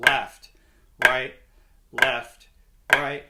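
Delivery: steady, metronomic stroke-by-stroke call; calling in rhythm. Shown as a demonstration. Drum teacher restarting the exercise on the left, calling in rhythm. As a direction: call each rudiment squarely in tempo.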